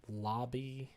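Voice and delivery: ordinary talk, nothing marked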